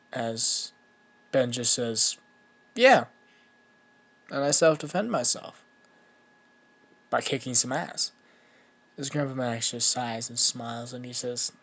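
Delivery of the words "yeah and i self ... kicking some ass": loud, higher-pitched cheeky retort; loud, boyish self-justification; cocky comeback. Acted. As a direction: justify yourself brashly, with a punchy start. Cocky boy justifies fight to grandpa.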